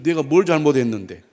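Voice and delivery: loud, indignant comeback; loud acted "what did I do?" outrage; baffled protest. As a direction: act a spouse caught off guard, raising the voice. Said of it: "라면서 황당해할 수도 있습니다".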